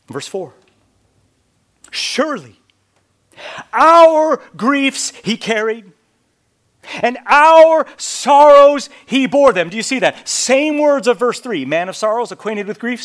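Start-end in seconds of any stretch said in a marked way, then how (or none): 1.9-13.0 preaching at full force. Acted. Preacher rereading verse four to the congregation, booming. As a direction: ring out every word of the verse.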